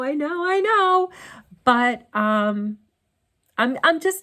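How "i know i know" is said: high, slow, drawn-out "I know, I know"; mock sheepish protest. Real, not acted. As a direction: playfully admit guilt. Mock guilty protest about downsizing.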